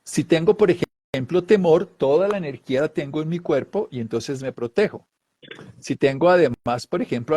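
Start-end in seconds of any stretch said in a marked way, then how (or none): none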